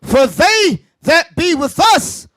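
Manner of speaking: slow, deliberate scripture reading; unhurried and weighty, phrase by phrase; slowly for listeners. Shown as a demonstration. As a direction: space the words out so the congregation follows. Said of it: "say it again slowly for them".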